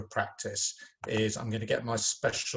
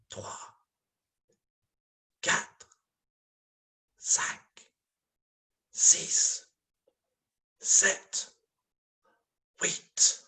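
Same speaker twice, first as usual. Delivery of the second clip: whisper; 6 semitones higher, much slower than usual. Shown as a demonstration. Teacher whispering the count for fun with pupils. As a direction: breathe the numbers, no voice. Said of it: "we're going to whisper it".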